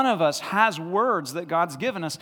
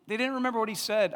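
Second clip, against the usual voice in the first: quick muttered repeat; 4 semitones higher, faster than usual. Shown as a demonstration.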